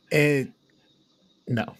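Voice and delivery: ordinary talk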